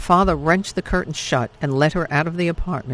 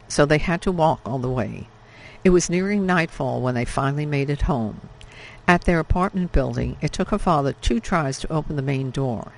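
plain reading voice

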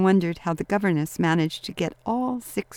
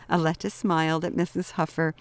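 plain reading voice

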